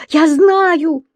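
put-on high, loud childish insistence; exclaiming. A narrator's reading. Еля insisting that the monster is there, exclaiming. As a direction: cry it out as a little girl. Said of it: "воскликнула Еля".

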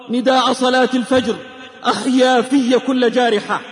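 usual speaking voice

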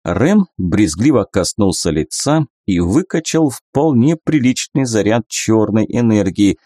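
plain reading voice

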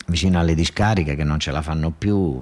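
usual speaking voice

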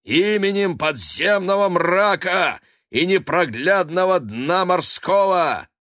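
shouted. Acted. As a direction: cry out a dark spell. Casting a curse.

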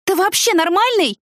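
very high, exasperated question; scolding shout. A narrator's reading. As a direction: scold him in disbelief. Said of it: "прикрикнула я на Дэнни".

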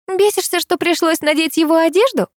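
high-pitched, relishing the taunt; gleeful teasing. A narrator's reading. Girl needling the driver over borrowed clothes, gleefully teasing. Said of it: "она наслаждается".

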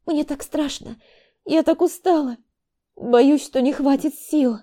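frightened and exhausted. A narrator's reading. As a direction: moan out fear and fatigue. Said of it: "простонала устало Вика".

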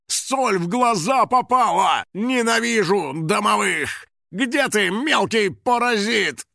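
high, slow wail of rage; stretched-out agonized shouting; pained, furious yelling. A narrator's reading. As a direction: rage blindly at the culprit. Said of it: "закричал он".

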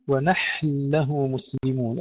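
slow "ونحن له", nun merged into a doubled lam; major idgham. Shown as a demonstration. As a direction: move from still ha straight into doubled lam in "ونحن له". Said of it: "الإدغام هنا الكبير للسوسي".

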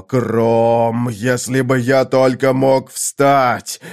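slow, low, helpless fury; breathed-out curses. A narrator's reading. Conan longs to crawl to the river, cursing slowly. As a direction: drag the words out heavily, bitter. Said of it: "выдохнул Конан".

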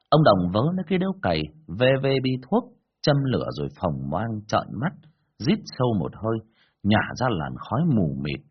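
plain reading voice

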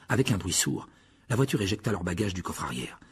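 ordinary delivery

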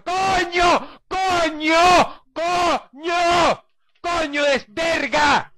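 high, slow cry of rage; anguished shout. Real, not acted. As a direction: wail it out in despair. Cry-shouts after failed checkpoint. (grito de rabia casi llorando)

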